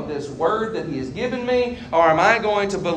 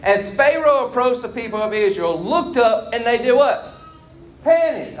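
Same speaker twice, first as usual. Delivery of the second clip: raised, animated scripture reading; dramatic scripture reading; 6 semitones higher than usual. Acted. Preacher reading Exodus to the congregation, animated. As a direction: read the Exodus passage with rising drama.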